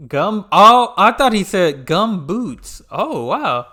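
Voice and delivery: loud, high, drawn-out disbelief; can't believe what he just heard; surprised disbelief. Real, not acted. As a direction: gasp out the word like it makes no sense. Reacting in disbelief to gumboots.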